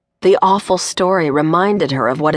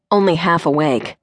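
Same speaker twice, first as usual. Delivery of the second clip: ordinary delivery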